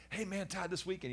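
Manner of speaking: high, sing-song fake friendliness; mocking bully. Boy mocking the classmates who teased him, high and sarcastic. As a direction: ape the bullies' sugary invitation.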